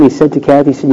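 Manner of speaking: ordinary delivery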